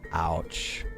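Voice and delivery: ordinary talk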